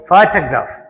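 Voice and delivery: Photograph with a strong ఫో, weak remaining syllables; stress on first syllable. Shown as a demonstration. Teacher modelling for students, first syllable of photograph stressed. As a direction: punch the opening ఫో of photograph. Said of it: "ఫోకి ఎక్కువ stress ఇచ్చాం".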